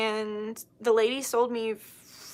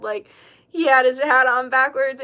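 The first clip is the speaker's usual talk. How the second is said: normal talking voice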